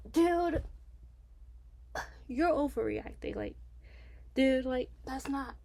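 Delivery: loud, high exclaimed "dude"; exasperated. Retold or imitated. Exasperated reaction to the boyfriend.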